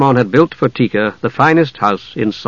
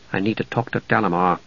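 softer, low muttering to himself; muttered; 5 semitones lower, 8 dB quieter than usual. A narrator's reading. Tanis muttering to himself after they left, softer. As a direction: mutter it under your breath. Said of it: "Tanis muttered".

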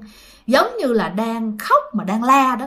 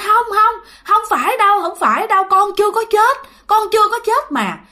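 high-pitched, pleading retold protest; desperate denial; 10 semitones higher, 3 dB louder than usual. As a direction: act a mother frantically refusing the truth. Mother refuses son's death.

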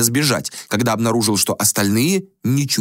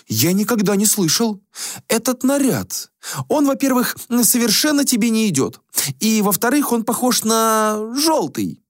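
put-on high, airy voice of a vain young prince; a high, languid young prince; prince Axius voice; 13 semitones higher than usual. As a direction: play the bored, spoiled prince. Prince character voice.

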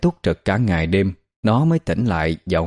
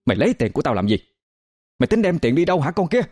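high-pitched screeching scold, rising on each cho mày; a furious aunt screeching at the child; shrieking tirade; 4 semitones higher, faster than usual. Acted. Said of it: "Bà ta bắt đầu tru tréo". (the thé chửi mắng)

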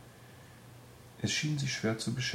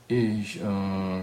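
louder, hesitant stammer; 6 dB louder than usual. A narrator's reading. Odd man caught out by the nurse, stammering. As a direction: stall and falter, caught out. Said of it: "stotterte Kakko".

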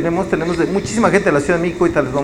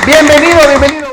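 high, loud announcer welcome; excited welcome; 9 semitones higher, 10 dB louder, faster than usual. Real, not acted. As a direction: welcome him like a star. Grand welcome of guest.

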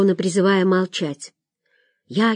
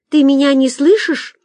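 higher, louder anxious question; nervous; 7 semitones higher, 5 dB louder than usual. A narrator's reading. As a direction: press nervously for an answer on the phone. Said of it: "занервничала женщина на том конце провода". (нервно, повысив голос)

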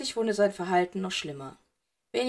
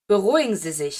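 a sharp, louder order to calm down; harsh command; 6 dB louder than usual. A narrator's reading. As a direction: snap him out of his panic, firmly. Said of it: "die Härte in seinem Tonfall".

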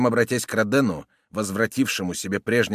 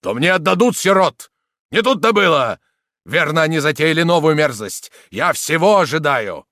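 loud, raised, bitter outburst; angry; 6 semitones higher, 9 dB louder than usual. A narrator's reading. Soldier angrily accusing the villain, angry. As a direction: rage at their scheming. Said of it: "он с гневом указал".

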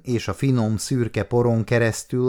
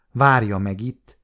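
ordinary delivery

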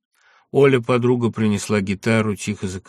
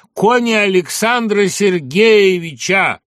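loud chorus cry; 11 semitones higher, 8 dB louder, slower than usual. Acted. Chorus joins the song.

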